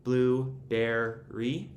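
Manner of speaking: blueberry drawn out slowly in three separate syllables. Shown as a demonstration. Teacher showing the syllables in a word, blueberry slowly split in three. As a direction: break blueberry into blue, ber, ry, slowly. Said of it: "there would actually be two other syllables".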